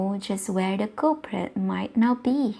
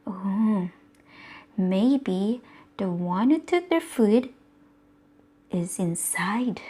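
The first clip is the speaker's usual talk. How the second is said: plain reading voice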